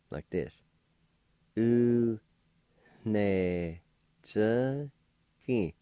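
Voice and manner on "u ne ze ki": U, ne, ze, ki drawn out separately with a nasalized N; nasalized N, syllable by syllable. Shown as a demonstration. Teacher shows learners the nasalized N, syllable by syllable. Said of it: "the N sound will be nasalized".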